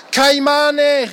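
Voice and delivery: high, drawn-out call; excited call. A narrator's reading. Mike spots caimans in the river below, calling out excitedly. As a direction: call out a sudden sighting. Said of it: "rief Mike".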